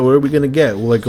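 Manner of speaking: usual speaking voice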